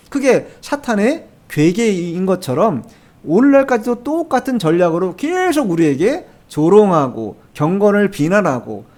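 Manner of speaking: ordinary talk, nothing marked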